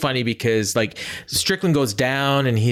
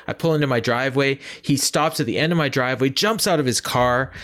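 ordinary delivery

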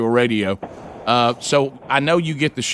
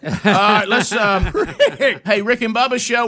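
laughing; 8 semitones higher, slower than usual. Real, not acted. Host laughing after the joke.

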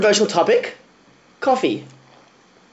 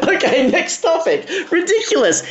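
high, laughing exasperation; 8 semitones higher than usual. Real, not acted. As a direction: laugh it off, pitch up. Laughing dismissal of coffee argument.

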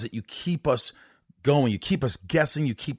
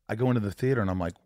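ordinary talk, nothing marked

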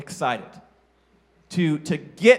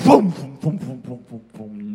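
engine sputter; 7 semitones lower than usual. Retold or imitated.

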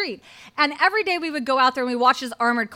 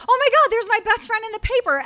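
much higher, thrilled outburst; excited exclamation; 11 semitones higher, faster than usual. Retold or imitated. Woman spotting her best friend in the paper, excited. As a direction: blurt it out in high-pitched excitement.

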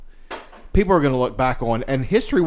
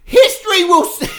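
put-on British accent; 7 dB louder than usual. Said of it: "John Oliver impression".

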